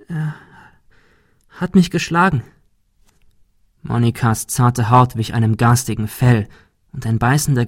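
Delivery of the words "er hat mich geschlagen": high, halting voice; hurt confession. A narrator's reading. Monika tells Ben of abuse, high and hurt. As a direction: let it out after a long pause.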